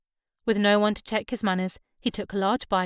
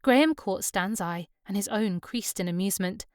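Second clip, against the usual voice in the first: plain reading voice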